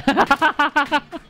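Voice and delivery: high, rapid burst of laughter; laughing. Real, not acted. Gamer who just spoiled someone's throw, laughing. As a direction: laugh in quick high pulses.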